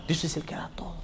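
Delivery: hushed whisper; whispered solemn reminder. Real, not acted. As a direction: breathe it as a secret to the church. Solemn repetition of key truth.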